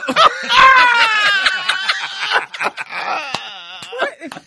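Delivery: high, loud howling laugh; shrieking laughter. Real, not acted. Comedian howling at his own punchline, shrieking laugh.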